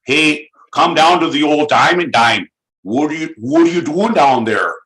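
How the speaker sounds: a light, loud Massachusetts twang; a hint of a local Worcester guy calling out; Worcester accent. Inviting someone to the Diamond Dime in a mild Worcester accent. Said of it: "The Worcester".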